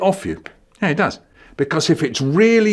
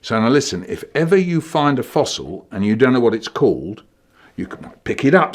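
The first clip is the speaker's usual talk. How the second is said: ordinary talk, nothing marked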